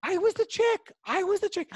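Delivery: very high-pitched voice; squealed mock confession. Man admitting he was the screamer, high squeal.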